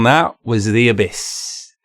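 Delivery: slowed, weighty delivery; slow dramatic restatement. Real, not acted. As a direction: let each word sink like a verdict.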